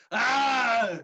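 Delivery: furious giant's slow bellow; cold rasping evil emperor. Acted. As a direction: roar the threat, drawn out. GM voices the giant Granny Johnny.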